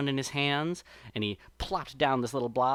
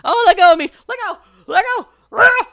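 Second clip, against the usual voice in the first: much higher, louder frantic screams to let go; desperate shrieks; 15 dB louder, faster than usual. A narrator's reading. Exhausted Brer Rabbit shrieks at the sap person to let go. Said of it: "Brer Rabbit's getting exhausted".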